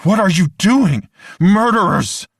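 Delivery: high, loud furious scream. Acted. Angela screams at the cheering crowd, loud furious scream. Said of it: "she started screaming at the people around her".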